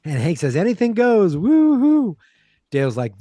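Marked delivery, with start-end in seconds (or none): 0.6-2.2 high, loud whooping cheer; party whoop. Retold or imitated. Host voicing Hank cheering on the party, whooping.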